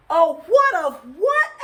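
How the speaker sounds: high, loud confusion; baffled loud question. A narrator's reading. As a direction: punch both WHATs in bewilderment. Buddy mishears again.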